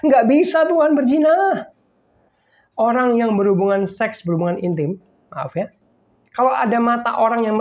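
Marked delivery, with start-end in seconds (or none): 0.0-1.7 high, loud, slow alarmed cry; startled protest. Retold or imitated. Woman caught by God's eyes, high alarmed protest. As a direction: caught in the act, recoil and protest. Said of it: "Lalu dia bilang gini".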